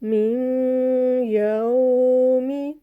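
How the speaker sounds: very slow chanted recitation; tajweed recitation. Shown as a demonstration. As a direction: hold each vowel long as in Quran reading. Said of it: "मैं दोबारा बता देती हूँ".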